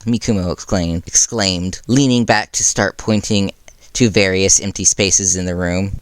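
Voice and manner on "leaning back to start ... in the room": plain reading voice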